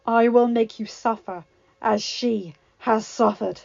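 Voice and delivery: beast's threat. Acted.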